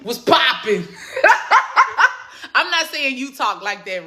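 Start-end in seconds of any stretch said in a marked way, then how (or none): 0.0-0.9 playful mock New York accent; teasing imitation of a New York accent; fake New York accent. Shown as a demonstration. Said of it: "that's my fake New York accent". 0.9-2.2 high, pulsing burst of laughter; real high-pitched giggle; giggling laugh. Real, not acted. Laughs at own fake accent.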